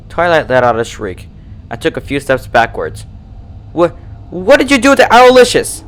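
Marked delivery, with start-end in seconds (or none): 3.7-5.8 high, loud, panicked stammer; horrified. A narrator's reading. Twilight finds her owl's blood, horrified. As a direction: stutter out the question in horror.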